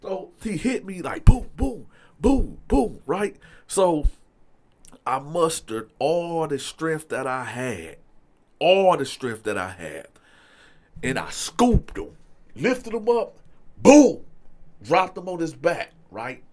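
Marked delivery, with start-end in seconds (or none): 1.2-3.0 punchy high "boom" for each blow; spoken blow effects, pitched up; imitated punch sounds. Imitating punches landing in the fight. 13.8-14.2 one big loud, high "boom"; explosive boom as the body hits down; imitated slam sound.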